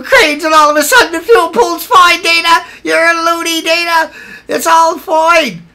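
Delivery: mock critic voice. Mocking critics who call him loony.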